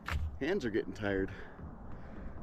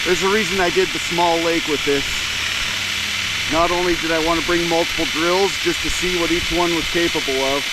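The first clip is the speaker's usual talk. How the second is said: louder, projecting over motor noise; raised voice; 3 semitones higher, 4 dB louder than usual. Real, not acted. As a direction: speak up over a running motor. Talking over the running motor.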